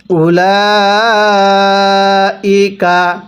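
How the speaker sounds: slow, drawn-out tajweed chanting; slow Quran recitation. Shown as a demonstration. Teacher demonstrating recitation to students, slow chant. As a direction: recite each syllable long and measured. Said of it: "লামের মধ্যে আমরা কয় আলিফ টান দিব".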